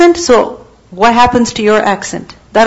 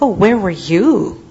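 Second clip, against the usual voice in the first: mocking retort, lower with swooping pitch; taunting; 4 semitones lower, 4 dB quieter than usual. Believer throwing someone's question back at them, taunting. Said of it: "someone who taunts excessively".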